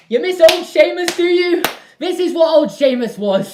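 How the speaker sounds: big hammed-up declaration; mock bold announcement. Telling viewers who miss the old channel, hammed-up loud. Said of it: "loud self-mocking return".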